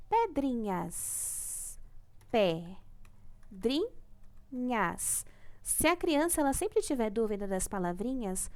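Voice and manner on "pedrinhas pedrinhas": "Pedrinhas" drawn out very slowly, syllable by syllable as pe-dri-nhas; "Pedrinhas" dito bem devagar, sílaba por sílaba; slow syllable split. Shown as a demonstration. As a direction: clap out "Pedrinhas" one syllable at a time. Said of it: "Agora, separando as sílabas".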